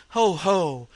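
loud gloating ho-ho; big mirthless stage laugh; booming laugh. A narrator's reading. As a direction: laugh it out, mad and triumphant. Madman gloats over the corpse.

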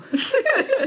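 a sudden high laugh at the joke; high burst of laughter. Real, not acted. [name] laughing at her own joke about Ben.

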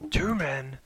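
dramatic trailer announcer; mock trailer narrator; movie trailer voice. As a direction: intone it like an epic film trailer. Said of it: "that's what I was going for".

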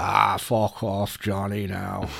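low, slow brush-off; retold dismissal. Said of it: "He was like".

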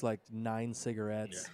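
ordinary talk, nothing marked

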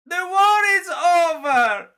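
high, loud, hammed-up movie-trailer yell; over-the-top impression of a trailer shout; shouted Obi-Wan quote. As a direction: belt it out like a dramatic trailer line. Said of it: "He's bloody done it".

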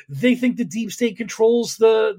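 usual speaking voice

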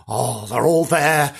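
ordinary talk

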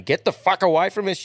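high, forceful retold warning; mock angry yell. Mate driving off the pursuing driver, mock angry.

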